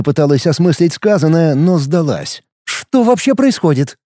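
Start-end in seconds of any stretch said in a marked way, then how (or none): 2.6-4.0 high bewildered question; lost and baffled, pitched up; confused woman. Acted. Kaida confused.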